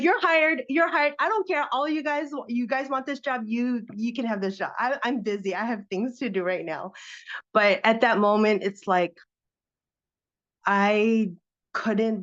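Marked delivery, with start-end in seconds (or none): 0.0-6.9 high, excited, dismissive rush; giddy brushing off. Retold or imitated. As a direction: toss the job away, giddy and higher. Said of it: "I'm like".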